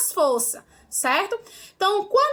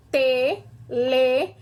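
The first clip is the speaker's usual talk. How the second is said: "Televisão" broken into slow syllables with gaps; 3 semitones lower than usual. Shown as a demonstration. Teacher counting syllables for pupils, "Televisão" syllable by syllable. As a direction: say "Televisão" as te, le, vi, são, pausing after each. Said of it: "Eu abro a boca quatro vezes".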